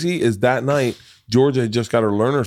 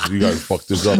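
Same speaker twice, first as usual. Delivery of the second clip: low, laughing Sweets impression; a lightly laughing take on Sweets' grumble; imitating Sweets; 6 semitones lower than usual. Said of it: "he goes".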